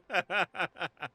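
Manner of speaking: burst of laughter. Real, not acted. Laughs at Santa costume.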